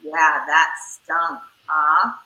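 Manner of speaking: put-on low, slow, sympathetic, to a disappointed kid; low slow empathy. Shown as a demonstration. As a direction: drop the voice low and slow, commiserating. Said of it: "so you go".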